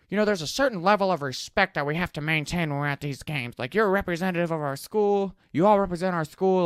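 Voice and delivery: high, put-on voice of a lecturing principal; stern principal lecture. Principal lecturing the assembly in a high put-on voice. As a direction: preach respect like a strict principal.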